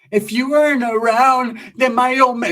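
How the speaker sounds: high, slow drawn-out mimicry; Sheila. Sheila telling Changa off, high drawn-out impression. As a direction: imitate her with stretched, high whine. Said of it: "that's how she talks".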